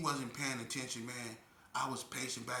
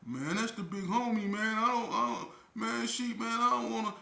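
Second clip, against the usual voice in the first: pleading cellmate; 11 semitones higher, 7 dB louder than usual. Retold or imitated. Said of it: "He like".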